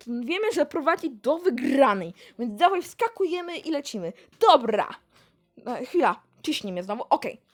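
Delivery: usual speaking voice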